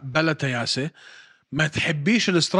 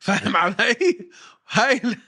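normal talking voice

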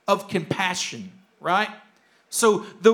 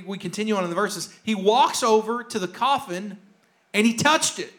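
ordinary delivery

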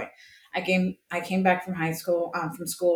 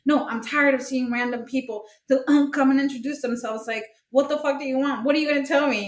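high, heated retold rant; exasperated tirade at an unwanted visitor; going off on someone; 6 semitones higher than usual. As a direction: act out fed-up indignation, pitch raised throughout. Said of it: "I went off on that lady".

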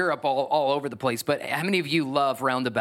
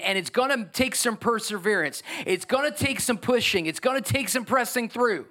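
higher and more urgent, building; fervent sermon build; rising preacher voice; 7 semitones higher than usual. Acted. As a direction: push the congregation forward.

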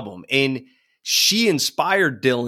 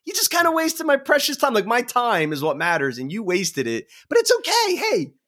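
much higher, giddy amazement; excited retelling; 11 semitones higher than usual. Retold or imitated. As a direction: gush at the song's cheeky line. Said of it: "and being like".

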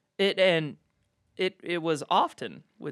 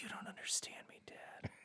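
whispered mock teen angst; sad emo kid; 22 dB quieter than usual. Emo kid to dad while posing for selfies, whispered angst. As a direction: whisper it with self-pitying drama. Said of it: "I was a sad emo kid from Seattle".

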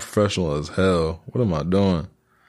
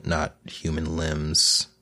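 normal talking voice

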